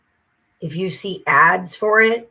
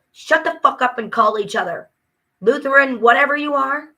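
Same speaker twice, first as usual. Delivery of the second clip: angry retort; 6 semitones higher, 5 dB louder than usual. Retold or imitated. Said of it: "It's like".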